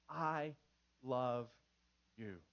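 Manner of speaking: low, soft, one word at a time; deliberate. Shown as a demonstration. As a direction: pause after each word, gently and quietly. Models the words moms need.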